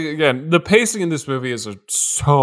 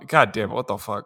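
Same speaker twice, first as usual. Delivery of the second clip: ordinary talk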